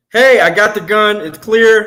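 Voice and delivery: shouting a warning to partners; loud call-out. Retold or imitated. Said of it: "what he shoulda did was".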